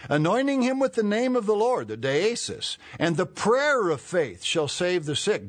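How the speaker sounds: loud, high preacher's Bible reading; scripture reading. Real, not acted. Preacher reads James with Greek word inserted, forceful scripture reading. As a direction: drive the verse home, loud and high.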